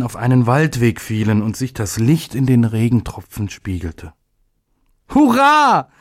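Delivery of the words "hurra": high, loud joyful shout; little day cheers at the sunbeams; cheering. A narrator's reading. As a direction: cry hurrah with delight. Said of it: "rief der kleine Tag".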